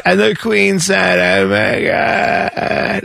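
slow creaky British accent; British vocal fry. Shown as a demonstration. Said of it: "Is that what I'm getting?".

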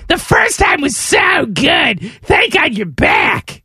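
high, slow mock rapture; drawn-out cheer of welcome; delighted toilet. Host voicing a toilet overjoyed he is back, high and slow. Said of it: "toilet voice continues".

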